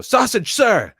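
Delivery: high, loud, drilled reply; recruit's shouted answer. Retold or imitated.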